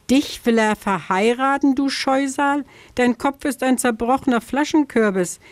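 mock the fox scornfully; mocking scarecrow. Scarecrow mocks vixen.

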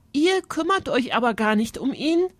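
calling out. A narrator's reading. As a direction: exclaim it as a reproachful girl, lightly acted. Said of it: "rief Alexa".

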